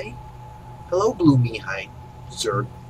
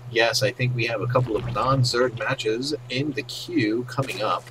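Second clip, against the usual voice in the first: usual speaking voice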